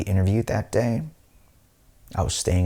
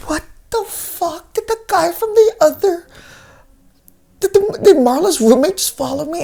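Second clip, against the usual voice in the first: high, squeaky alarm, growing louder; panicked disbelief; 7 dB louder than usual. Retold or imitated. Teller woken by wailing on first night, panicked.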